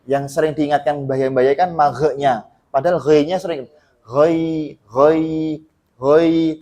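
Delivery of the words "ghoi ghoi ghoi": "ghoi" in separate, evenly drawn beats; three-beat ghoi. Shown as a demonstration. Teacher drills the often-missed syllable, measured ghoi. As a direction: repeat "ghoi" steadily with a throaty gh. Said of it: "tiga ketukan".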